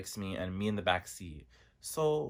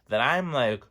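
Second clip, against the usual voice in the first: ordinary talk, nothing marked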